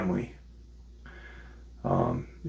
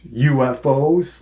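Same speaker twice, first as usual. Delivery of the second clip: drawn-out, higher, louder mock question; 5 semitones higher, 7 dB louder, slower than usual. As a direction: imitate someone mocking a wild claim. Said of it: "just kinda mocking him".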